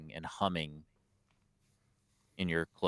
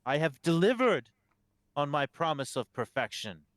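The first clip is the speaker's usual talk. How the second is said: raised pitch, ringing on the first phrase; proclaiming abbot; 7 semitones higher, 4 dB louder than usual. Acted. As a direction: declare it to a whole congregation.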